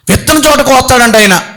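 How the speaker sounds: normal talking voice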